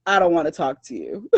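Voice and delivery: mock sassy brush-off to missionaries. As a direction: wave them off with a laugh. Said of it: "I was like".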